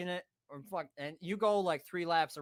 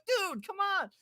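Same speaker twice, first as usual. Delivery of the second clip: high, loud plea to get moving; retold urgent protest; 5 dB louder, slower than usual. Friends hurry him away from the cooler, urgent retelling. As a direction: imitate exasperated friends urging him on.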